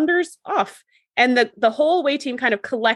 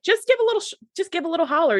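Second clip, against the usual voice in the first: high exasperated plea; pleading with a clueless character; high, quick, exasperated urging; 7 semitones higher, faster than usual. Retold or imitated. Frustrated advice to character.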